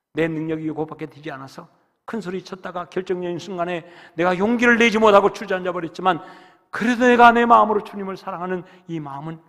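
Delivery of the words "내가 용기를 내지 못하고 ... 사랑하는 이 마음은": fervent confession. Acted.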